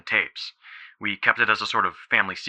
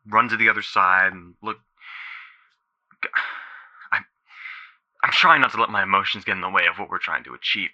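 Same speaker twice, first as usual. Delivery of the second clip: usual speaking voice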